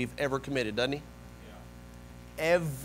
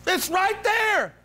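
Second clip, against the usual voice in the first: high, drawn-out protest; acted frustrated accuser, stretching the words; infuriated Satan; 4 dB louder, much slower than usual. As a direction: insist in high-pitched exasperation. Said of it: "how infuriated Satan gets".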